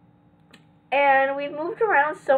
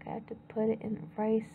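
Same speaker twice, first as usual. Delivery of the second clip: ordinary delivery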